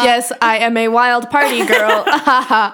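put-on flat robotic party girl. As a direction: mechanical laugh, lightly robotic. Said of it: "Killers are robots".

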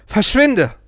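a man driving the guru away; scream. A narrator's reading. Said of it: "schrie ich".

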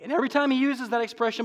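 ordinary talk

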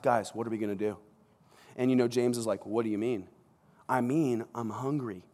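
hushed plotting among friends; conspiratorial hush. Retold or imitated. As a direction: disciples huddling aside, murmuring about food. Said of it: "Peter probably grabs the guys".

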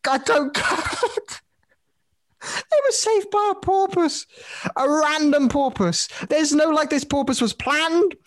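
high-pitched, laughing disbelief; laughing incredulously. Real, not acted. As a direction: struggle to talk through helpless laughter. Incredulous laughing rant about porpoise.

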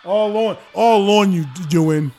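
loud, high highlight cheer; excited shout. Real, not acted. Watching Jordan dunk on a defender, excited shout. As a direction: crow over the dunk at full voice.

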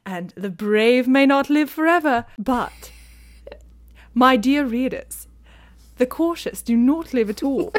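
hammed-up British society narrator; Lady Whistledown. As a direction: deliver it grandly in mock British, with pauses. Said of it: "me imitating Li-, Lady Whistledown".

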